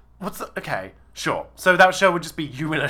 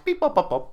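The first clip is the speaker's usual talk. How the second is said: high robotic beep bop sounds; computer noises; 11 semitones higher than usual. Shown as a demonstration. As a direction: imitate a computer beeping. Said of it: "Computer noises".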